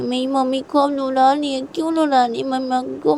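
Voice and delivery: put-on high, plaintive child begging father; pleading little daughter. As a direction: plead like an upset small child. बच्ची पिता से गुहार लगाती है. (पापा से गिड़गिड़ाती छोटी बच्ची)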